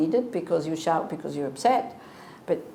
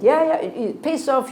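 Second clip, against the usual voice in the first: imitating an angry brush-off; 5 semitones higher, 6 dB louder than usual. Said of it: "And he said".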